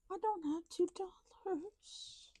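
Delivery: breathy high whisper repeating it. Shown as a demonstration. Saving money on camera, high whisper to viewers. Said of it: "but I don't have two dollars".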